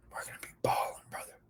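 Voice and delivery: hushed conspiratorial whisper; soft whispered promise to Bird. Acted. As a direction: whisper it like a secret plan. Whispered hype aside.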